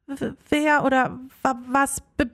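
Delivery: stuttering on the first sounds; halting, tripping over the words; stammering. A narrator's reading. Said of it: "stammelte Leonie".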